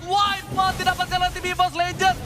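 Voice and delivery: loud excited play-by-play; esports caster. Real, not acted. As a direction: keep the hype up on the call. (komentator esports bersemangat)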